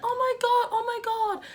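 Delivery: high, panicky mock squeal; women in the car panicking, mimicked high; mocking frightened women. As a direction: imitate them freaking out. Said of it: "and they're like".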